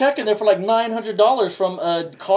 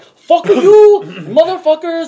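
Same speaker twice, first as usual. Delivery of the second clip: much higher, louder mock yell at the caller; mock scammer yell; 13 semitones higher, 11 dB louder, much slower than usual. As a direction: bellow it as the angry scammer. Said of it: "thick ass Indian accents".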